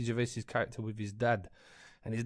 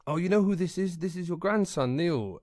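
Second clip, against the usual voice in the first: higher, louder, coaxing mother's voice; mother to nan; 7 semitones higher, 5 dB louder than usual. Retold or imitated. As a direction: present the grandson to her, raised pitch and volume. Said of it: "my mother was there with me, and she said".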